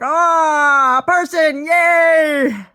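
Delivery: high loud puppy shout; hammed-up bouncing puppy; excited puppy voice. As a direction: yell it like an overjoyed puppy. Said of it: "They'd be like".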